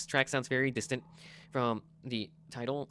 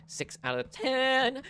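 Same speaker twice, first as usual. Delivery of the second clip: slow drawn-out score; 9 semitones higher, much slower than usual. Shown as a demonstration. Score announced slowly.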